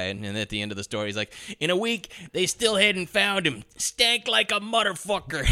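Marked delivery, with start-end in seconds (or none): 1.6-5.4 mock gangster drawl. Quoting the mob informant.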